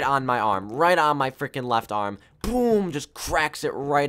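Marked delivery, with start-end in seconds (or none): 2.4-4.1 retelling the moment his arm cracked; dramatic boom. Retold or imitated. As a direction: punch it like an impact. Arm breaking in story.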